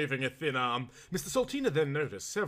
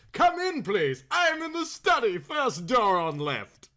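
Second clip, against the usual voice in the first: high, loud, sing-out welcome from afar; cheery call; 9 semitones higher, 6 dB louder, slower than usual. A narrator's reading. Earl calling visitor into the study from the distance, cheery. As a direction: ring it out cheerily to someone down the passage. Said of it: "a cheery voice rang out from the distance".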